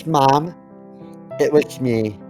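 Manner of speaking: ordinary talk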